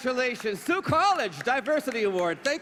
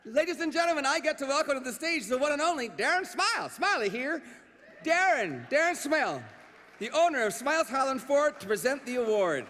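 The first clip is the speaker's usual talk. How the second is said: high, bright emcee introduction; announcer voice; 5 semitones higher than usual. Acted. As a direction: build him up for the crowd. Host announcing the guest.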